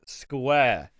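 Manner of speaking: normal talking voice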